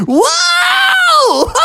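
very high "whoa" into cackling laugh; wild falsetto scream of glee; thrilled whoop. A narrator's reading. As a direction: whoop like you're launched skyward. Owl flung into the air.

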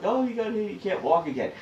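high, put-on plaintive lawyer's voice; mock injury claim. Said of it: "They're like".

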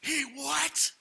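high, loud mock outrage; outraged. Pastor learning the gift was passed on, comically outraged. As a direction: burst out high in disbelief. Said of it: "I was so mad".

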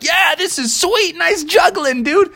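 high, cheery praise; a happy high-pitched cheer; excited moose. Acted.

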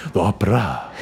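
mock-grand low "opera"; affected pronunciation. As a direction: say "opera" grandly with an open final ah. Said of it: "as you say it".

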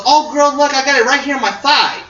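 put-on high, girly show-off voice; excited stripper. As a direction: mimic an excited girl showing her friend. Retold stripper showing matching tattoo.

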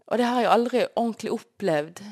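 usual speaking voice

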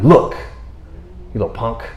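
slow, mock-menacing scolding; mock tough God. God collaring Jonah, slow mock threat. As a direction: grab him by the collar, slowly. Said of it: "That's when we grab him by the collar".

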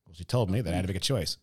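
low, fast dopey voice; mumbled low dude; dopey guy voice. Retold or imitated. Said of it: "two guys sitting there going".

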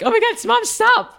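high, loud squealing plea to mom; teary fan begging mom to stop, in a high squeal; whiny protest. Retold or imitated. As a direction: squeal it out, half-laughing, half-tearful. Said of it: "I was like".

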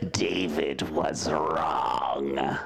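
slow, drawn-out ghostly wail; spooky voice. Shown as a demonstration. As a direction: moan it out like a haunting ghost. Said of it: "I'll say it in my spooky voice".

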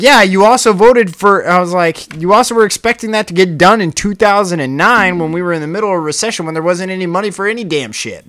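retold heated rant. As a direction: lay into him, fed up and loud. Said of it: "your rant".

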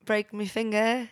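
put-on British accent. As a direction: plead in a mock British accent. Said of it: "Br-, British".

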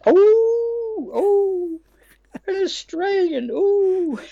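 very high, loud camp shriek of surprise; hammed-up high shock at finding an Australian; shocked Frankie. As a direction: squeal it out, scandalised and delighted.

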